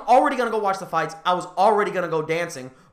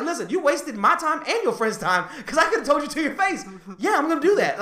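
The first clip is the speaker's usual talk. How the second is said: high-pitched, animated telling-off; worked-up rebuttal, pitched well up; retold indignant comeback; 8 semitones higher than usual. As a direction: argue back, voice raised high, laughter creeping in. Retold argument, telling her off.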